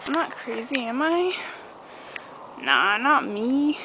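ordinary talk, nothing marked

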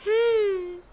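high, long thoughtful hum; pondering hum. A narrator's reading. Rabbit wondering what he can do, high pondering hum. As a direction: hum as if searching for an idea. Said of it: "thought the rabbit".

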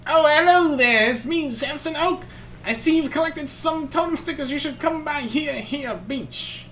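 put-on high, old professor greeting kindly; lightly cartoonish old professor; Professor Oak voice. Voicing Professor Samson Oak.

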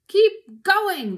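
loud, high farmer's yell; shouting. A narrator's reading. Boggis to Bunce and Bean, loud high shout. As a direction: yell it across to the others. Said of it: "the fat Boggis shouted".

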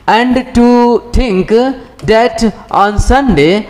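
measured classroom reading; slow textbook reading. Acted. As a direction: read out phrase by phrase, drawn out. Reads the English text aloud.

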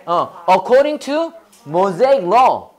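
very slow, chunk by chunk; slow chunked reading. Shown as a demonstration. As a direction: read it out in slow chunks for repeating. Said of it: "저 청크로". (덩어리로 끊어 천천히 읽기)

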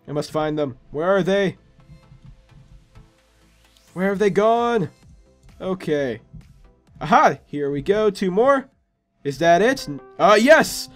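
ordinary delivery

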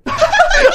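short, high burst of laughter. Real, not acted. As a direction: crack up after yelling. Laughing after own yell.

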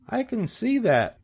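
high, slow piglet voice; young pig. A narrator's reading. Pig taking in the wide world, high and slow. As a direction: draw it out in a high voice.

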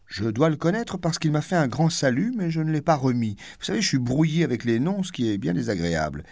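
smug complaint, enjoying it; self-satisfied. Acted. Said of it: "dit-il d'un air de satisfaction".

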